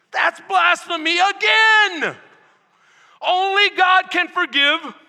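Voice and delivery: high, full-volume outraged shout; fuming skeptics. Acted. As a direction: shout the accusation in boiling indignation. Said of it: "they are just fuming inside".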